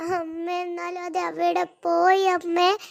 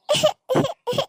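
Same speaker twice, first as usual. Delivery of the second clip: sobbing wail; 4 semitones higher than usual. Acted. Sobbing in the pit.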